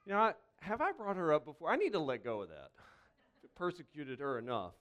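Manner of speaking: ordinary talk, nothing marked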